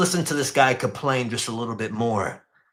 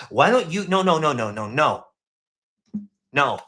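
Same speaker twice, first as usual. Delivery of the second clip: ordinary delivery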